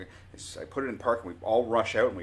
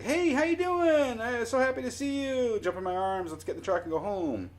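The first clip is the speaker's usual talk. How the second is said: excited greeting; 5 dB louder than usual. Acted. Imagined happy reunion with the dog, excited high greeting. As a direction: squeal a joyful welcome, stretching the first words.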